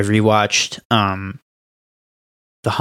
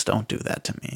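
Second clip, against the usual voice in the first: hushed plea; 11 dB quieter than usual. Real, not acted. Pleading after a friend mocks Stranger Things.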